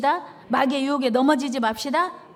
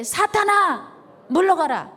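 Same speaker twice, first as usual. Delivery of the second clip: loud, high command to Satan; Jesus rebuking Satan loudly; commanding rebuke; 5 semitones higher, 6 dB louder, slower than usual. Acted. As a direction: drive the devil off with force. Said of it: "예수께서 말씀하시되".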